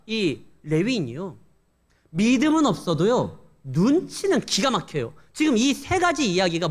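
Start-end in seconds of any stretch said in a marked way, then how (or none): none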